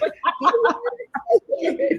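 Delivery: high-pitched burst of laughter; real laugh. Real, not acted. Laugh after mock crying.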